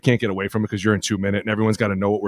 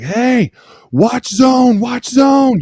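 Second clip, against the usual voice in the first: much higher, loud shouted warning; defender's call-out; 14 semitones higher, 6 dB louder, much slower than usual. Retold or imitated. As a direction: yell it across the line of scrimmage. Defender calling out the play.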